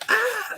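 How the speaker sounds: short, very high mock scream; imitated scream. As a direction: let out a high, contained yelp of a scream. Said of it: "hear this guy scream like John screams".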